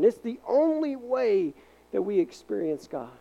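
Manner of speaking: rising, emphatic altar-call preaching; preaching at full force. Acted. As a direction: drive the truth home to the church. Preaching climax.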